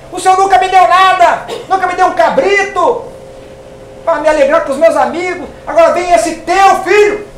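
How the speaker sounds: put-on older son shouting his grievance at his father; resentful son. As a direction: complain bitterly to the father, building to a shout. Older brother's complaint to father.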